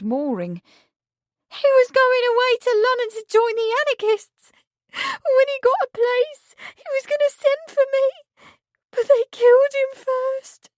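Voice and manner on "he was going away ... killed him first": high, loud, desperate wail; grieving maid wailing. A narrator's reading. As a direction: cry it out as if the world has ended. Meg wailing about Marsh's death.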